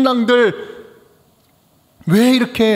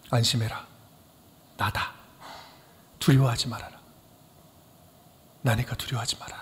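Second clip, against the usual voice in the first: much softer, low, calm reassurance; reassuring Jesus; 9 semitones lower, 10 dB quieter than usual. Acted. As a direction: speak softly as Jesus calming frightened disciples. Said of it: "그리고 말씀을 하십니다". (제자들을 안심시키는 부드러운 낮은 목소리)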